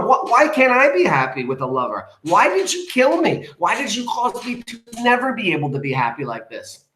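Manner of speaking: high-pitched, hammed-up wailing protest; yelling at mom. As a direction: wail accusations at mother, melodramatically. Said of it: "she's yelling at the mom".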